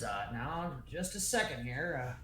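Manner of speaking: slow, hesitant, lightly voiced older woman; measured homeowner, probing cautiously; older woman, calmer. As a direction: stall politely while sizing up strangers. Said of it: "the older woman says".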